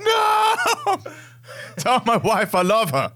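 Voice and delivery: high, laughing dying plea; hammed-up last words, cracking up; wounded soldier gasping. Mock dying soldier farewell.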